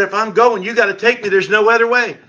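man crying out to God for salvation, loud and pleading; pleading prayer. Retold or imitated. Desperate prayer recalled.

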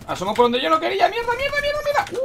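shrieked high curses; high panicked cursing. Real, not acted. Enemy peeks from wrong side.

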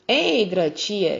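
a drawn-out, startled oath; exclaiming. A narrator's reading. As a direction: draw out the curse as you exclaim. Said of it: "exclamă glasul".

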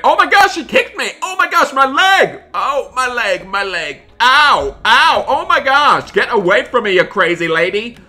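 very high, loud cries of pain; hammed-up panicked squeal of pain; pained yelping. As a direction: yelp as if kicked in the leg. Kicked in the leg.

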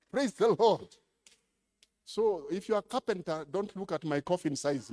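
usual speaking voice

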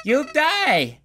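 high, punched DIE, falling off; villain's threat. Acted. Villain threatening the player with death, high and gleeful.

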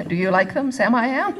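loud, laughing storybook lilt; laughing sing-song reading. Acted. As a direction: read it through laughter. Reading to children, laughing loudly.

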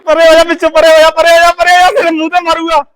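high, insistent calling to get clear; urgent warning. Real, not acted. Host to bystander in the stick's path, urgent warning.

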